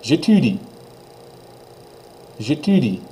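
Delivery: j'étudie with a French u; teacher demo; French pronunciation. Shown as a demonstration. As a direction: model j'étudie. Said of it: "répétez".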